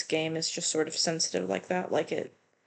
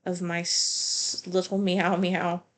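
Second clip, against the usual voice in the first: ordinary talk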